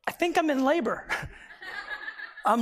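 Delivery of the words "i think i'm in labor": lightly voiced wife, pitched higher; halting, high-voiced announcement; imitated wife. Wife calling her husband at work with news, tentative imitated voice.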